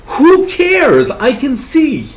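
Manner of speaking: high, loud, jubilant brush-off; elated dismissal. Acted. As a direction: shrug off every trouble in sheer joy. Cured man gleefully dismisses his troubles.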